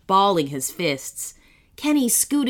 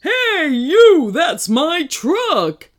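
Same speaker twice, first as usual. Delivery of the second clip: loud, high roar, slowing at the end; angry roar; 9 semitones higher, 7 dB louder, much slower than usual. A narrator's reading. Truck owner to those driving it away, angry roar. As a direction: roar after the thieves. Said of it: "roared a voice from behind them".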